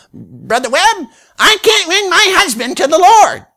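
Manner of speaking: high, far shifted woman's voice, well above his own; mimicking the woman. Preacher's father mimics the upset churchwoman's complaint, high whiny voice. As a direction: pitch it way up into a whiny church lady.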